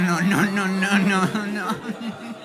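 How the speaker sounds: loud, laughing protest no; panicked no. Retold or imitated. To husband, a loud panicked laughing protest. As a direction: shout the refusals in mock panic.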